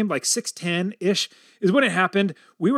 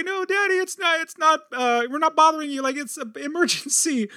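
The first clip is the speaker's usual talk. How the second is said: put-on high, rushed childlike pleading; kids pleading; 10 semitones higher than usual. Kids at the office door insisting it's an emergency, high and pleading. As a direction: voice the kids begging Daddy to listen. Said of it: "I hear all my kids say".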